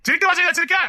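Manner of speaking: high-pitched telling-off; angry scolding. Acted. Scolding her on her return. (கோபமான அதட்டல்)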